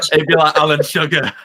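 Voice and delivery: real laughter breaking in as you speak; laughing. Real, not acted. Laughs at own bad impression.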